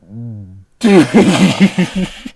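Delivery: loud helpless laugh; loud laughter. Real, not acted. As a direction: let a big laugh out. Laughing again at the line.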